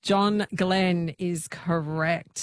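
slow, stretched "John Glenn is correct"; game-show host stretching out the win; drawn-out verdict. Shown as a demonstration. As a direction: savour each word of the verdict. Quizmaster announcing correct answer.

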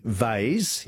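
vase said like "vayz"; vase pronounced to rhyme with haze; vase rhyming with haze. Shown as a demonstration. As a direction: say vase with a long ay and a z. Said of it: "rhymes with haze".

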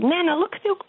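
put-on high, piping little-girl voice calling for Nana's attention; toddler's voice. Grandmother voicing her toddler granddaughter, high little-girl voice. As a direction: imitate an eager toddler tugging at Nana.

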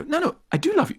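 mock reassuring. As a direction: hurriedly placate the partner. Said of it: "you wanna go".

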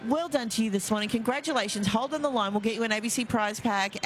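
ordinary talk

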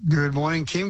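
ordinary talk, nothing marked

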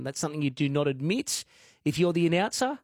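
ordinary delivery

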